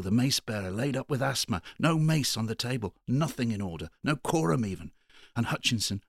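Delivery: high, loud, fast fretful complaining; petulant rant. A narrator's reading. Fussy town clerk complaining to the sub-sheriff, pettish and loud. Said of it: "said pettishly".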